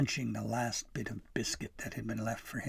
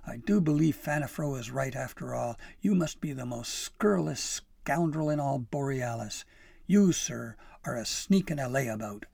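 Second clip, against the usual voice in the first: loud, indignant tirade; heated, emphatic telling-off; raging; 3 semitones higher, 7 dB louder than usual. A narrator's reading. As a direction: scold hard, leaning on each accusation. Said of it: "she raged".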